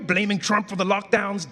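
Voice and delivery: ordinary talk, nothing marked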